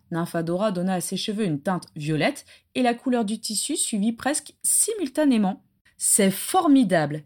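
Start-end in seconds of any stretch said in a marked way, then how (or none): none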